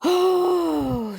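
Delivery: moon voice. Pretend. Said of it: "rambling moon".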